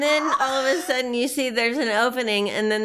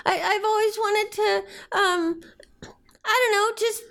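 high, teary and whispery; tearful; 11 semitones higher than usual. Acted. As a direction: choke up with happy tears. [name] voiced tearful.

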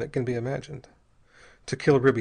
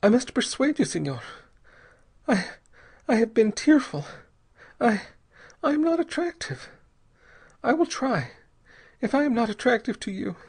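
put-on high, pleading young woman's voice; frightened young mother; 11 semitones higher than usual. A narrator's reading. Young mother pleads with Bell, high and frightened. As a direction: voice her as a terrified wife begging.

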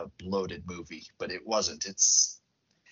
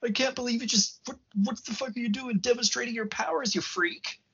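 much higher, outraged squeal; freaking out; 11 semitones higher than usual. Retold or imitated. Shaken pundit shrieks at the psychic, pitched way up. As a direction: panic at what he just heard. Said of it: "the dude starts freaking out".

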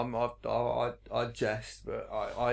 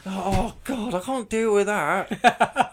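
mock exasperation; 7 semitones higher, 6 dB louder, slower than usual. Acts out reaction to telly switch.

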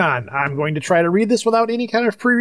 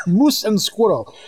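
put-on Russian accent; 3 semitones higher, slower than usual. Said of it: "the Russian voice".

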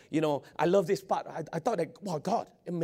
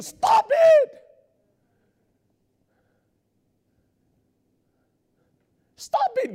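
much higher and louder, yelling stop it; shouting rebuke; 10 dB louder, much slower than usual. Real, not acted. Preacher commanding the congregation to stop, shouting. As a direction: shout the lies down hard.

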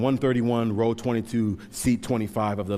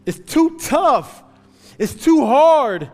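loud, high pleading cry to God; desperate prayer; 12 semitones higher, 9 dB louder than usual. Acted. Believer pleading with God in hard times, loud high and strained.

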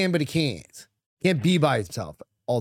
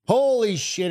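excited exclamation; 8 semitones higher, slower than usual. Real, not acted. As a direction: blurt it out, thrilled. Bursting out at the show start.

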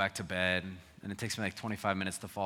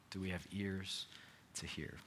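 soft repeat; 7 dB quieter than usual. Acted. Repeated softly.

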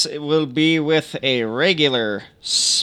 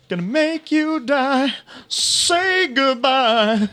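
menacing villain taunt; 7 semitones higher, slower than usual. Acted.

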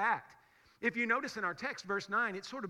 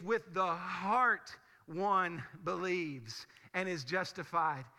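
slow emphasis; 2 semitones lower, slower than usual. Real, not acted. Slow emphatic repetition of verse.

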